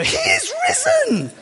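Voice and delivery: very high, shouted witch cry; witchy shriek. Retold or imitated. Old lady jumping out at young preacher, shrieking. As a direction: leap out screaming it like a witch. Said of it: "she'd jump out and she'd go".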